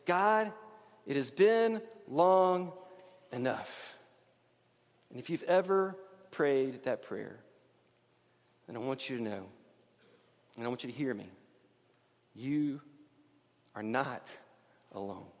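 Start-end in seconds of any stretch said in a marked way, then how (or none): 0.0-4.0 slow, drawn-out plea fading to a breath; weary exasperation. Shown as a demonstration. Preacher voicing a tired prayer, slow and heavy. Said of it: "What we're really saying is". 8.7-15.3 gentle reassurance. Real, not acted. Pastor telling hurting listeners they are not alone, softly. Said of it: "pastor comforting the struggling".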